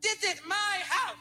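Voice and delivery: put-on slurred, belligerent drunk dad; drunk father. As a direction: rant like a drunk father at home. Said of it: "My pop's home like this drunk".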